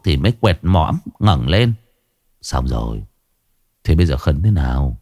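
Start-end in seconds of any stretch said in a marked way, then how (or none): 2.4-5.0 weak sick man. Acted. Sick man after eating.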